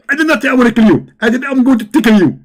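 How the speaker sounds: high, loud preachy mimic; Zakir Naik. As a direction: lecture like an excited preacher. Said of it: "Zakir Naik".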